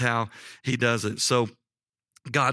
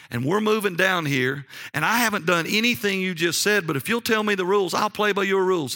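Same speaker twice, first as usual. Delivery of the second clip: higher-pitched, insistent protest of innocence; earnest self-defence; 7 semitones higher than usual. Retold or imitated. Young salesman defending himself to an accusing client, earnest protest. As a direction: plead your case, pitch raised.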